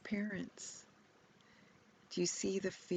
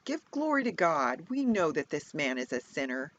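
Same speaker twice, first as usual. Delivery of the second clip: louder, pressing; stern demand; 8 dB louder than usual. Acted. Pharisees to the blind man, loud stern demand. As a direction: lean hard on him.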